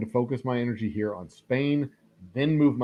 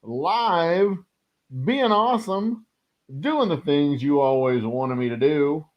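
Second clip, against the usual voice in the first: high, loud hyped-up stream host opening; hype intro; 9 semitones higher, 6 dB louder, slower than usual. Acted. Host kicking off the live stream, hyped announcer voice. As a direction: pump up the live audience like a showman.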